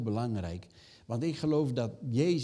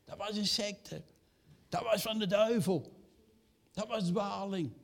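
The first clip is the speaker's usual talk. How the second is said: mocking critic; 8 semitones higher than usual. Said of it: "hoe werden we niet genoemd".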